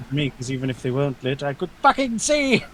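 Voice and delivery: loud, indignant outburst; rising to a yell; emphatic shout. Real, not acted. Player emphatic rant.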